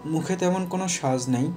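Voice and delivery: ordinary talk, nothing marked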